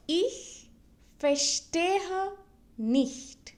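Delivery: slow German, a pause after each of "Ich", "verstehe", "nicht"; word by word. Shown as a demonstration. Teacher models the phrase for learners to repeat, word by word. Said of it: "repeat it twice so that you can listen".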